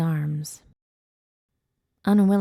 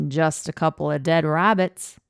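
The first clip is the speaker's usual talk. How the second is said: put-on louder line in an Australian accent; 5 dB louder than usual. A narrator's reading. Tavis placating armed strangers, Australian accent. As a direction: answer the challenge with an Australian accent. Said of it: "he said in his Australian accent".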